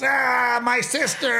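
put-on high loud little-boy cry; big brother grabbing sister's face, voiced high and loud; imitating excited boy. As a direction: play a rough, happy big brother. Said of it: "Dude, he'll grab her face".

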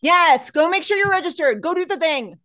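high, loud cheerleading call to action; excited, emphatic push; rousing urging. Real, not acted. Urging listeners to go vote.

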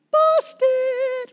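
squeaky high "Busted", loud; caught out. Retold or imitated. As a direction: yelp it like someone caught red-handed. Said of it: "she's just like".

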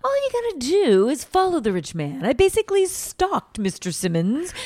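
high, loud lady holding court; imitating Mama D. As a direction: lord it over the room. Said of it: "she's, like, holding court".

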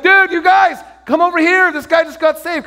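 high, loud holler to friends; excited calling over. Retold or imitated. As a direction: shout them over with thrilling news.

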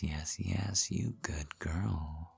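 breathy whisper. Acted. Unvoiced whisper mid-scene.

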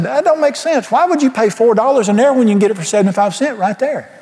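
high-pitched incredulous protest; baffled protest. Retold or imitated. Man questioning the Lord about bar prices, baffled. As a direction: pitch it up, imitating your own disbelief.

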